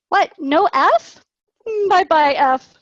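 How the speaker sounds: high, loud playful astonishment; a hammed-up, high oh-no to the missing F; mock surprise. Shown as a demonstration. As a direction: act amazed for a child. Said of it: "teacher demo, playful".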